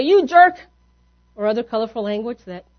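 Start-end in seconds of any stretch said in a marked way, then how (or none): 0.0-0.6 high, loud mock outburst; angry insult. Offended driver cursing whoever backed into their car, angry outburst.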